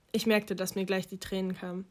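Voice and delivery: plain reading voice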